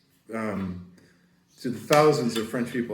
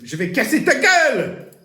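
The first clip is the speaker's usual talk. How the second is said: much higher, loud threatening shout; furious threat; 13 semitones higher, 9 dB louder than usual. Retold or imitated. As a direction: bark the threat at a stranger. Acted threat in a fight.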